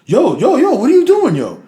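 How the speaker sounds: high-pitched incredulous challenge; startled protest, imitating a friend; alarmed objection. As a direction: cut in urgently, voice jumping up high. Chase confronts man lighting up indoors.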